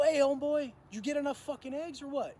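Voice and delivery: loud, high mock challenge; sarcastic callout. Calling out the greedy cellmate at the table, sarcastic. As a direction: needle him with a loud jab.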